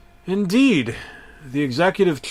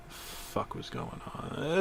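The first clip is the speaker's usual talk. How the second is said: slow whisper to yourself; confused whisper; 4 semitones higher, 15 dB quieter, slower than usual. Acted. Narrator whispering confusion at the tape. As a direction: mutter it under your breath in disbelief.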